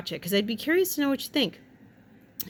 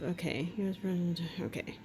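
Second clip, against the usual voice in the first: breathy whispered aside; 3 semitones lower, 9 dB quieter than usual. Real, not acted. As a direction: drop to a whisper. Whispered aside reading headline.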